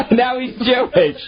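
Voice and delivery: laughing hard while talking high. Real, not acted. Host cracks up at the herpes joke, laughing. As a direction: say it through laughter.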